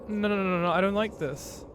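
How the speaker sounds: pleading with the game to stop; anxious. Real, not acted. Panicking, can't grab anything.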